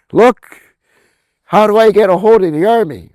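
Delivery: loud urgent call. Retold or imitated. Calling police about the army.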